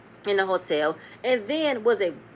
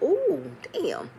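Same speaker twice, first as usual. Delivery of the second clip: high, drawn-out ooh falling away; mock-stunned exclamation; stunned reaction; 6 semitones higher than usual. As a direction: react like that one landed hard.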